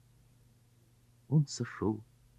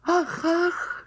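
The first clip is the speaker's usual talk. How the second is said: excited gasps. Acted. Sonya jumps up.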